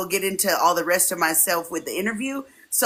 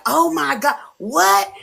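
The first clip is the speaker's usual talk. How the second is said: mock surprise; 8 semitones higher, 4 dB louder, slower than usual. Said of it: "we were just clowning".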